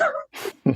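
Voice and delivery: high laugh. Real, not acted. Laughing at own story.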